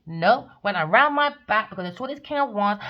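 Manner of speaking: ordinary delivery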